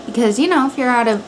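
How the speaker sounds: ordinary talk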